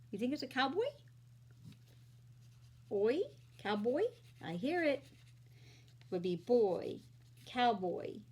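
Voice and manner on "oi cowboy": ordinary talk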